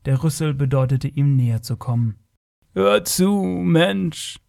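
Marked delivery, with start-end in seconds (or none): 2.7-4.4 high, otherworldly creature voice; stretched summons from an alien being; strange being. A narrator's reading. Strange being calls the human to listen, solemn and otherworldly. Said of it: "sagte das merkwürdige Wesen".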